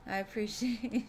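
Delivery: real giggle taking over the words; laughing thanks. Real, not acted. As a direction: voice rising into a laugh. Breaks into laughter while thanking chat.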